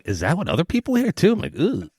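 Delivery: mock dismay, imitating oneself; retold self-disgust. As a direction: recoil at your own voice. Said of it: "I'm always like, I was like".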